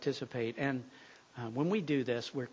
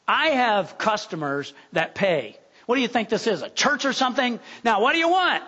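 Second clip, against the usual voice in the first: climbing higher and louder, scolding; angry cook; 6 semitones higher, 4 dB louder than usual. Acted. Greasy-apron cook scolding a freezing woman, building to angry. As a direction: berate her until it's nearly a shout.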